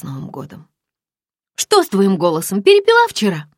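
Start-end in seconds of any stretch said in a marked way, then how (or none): 1.5-3.5 much higher, louder teasing friend; chirpy girlfriend teasing on the phone; friend's voice. Acted. Friend teases her about a hangover, high and loud.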